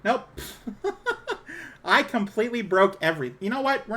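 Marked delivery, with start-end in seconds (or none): 0.3-1.8 high burst of laughter. Real, not acted. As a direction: laugh at the broken setup. Broke the stream audio, laughs.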